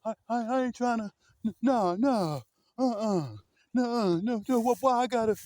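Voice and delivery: high, stammering whiny protest; mock hollering. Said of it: "hooping and hollering and screaming".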